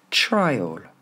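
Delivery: TRIAL as "TRY-ol", falling; one last model of "trial", strong TRY; first-syllable stress. Shown as a demonstration. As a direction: punch TRY, light "ol" ending. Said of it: "l'accent tonique sur la première".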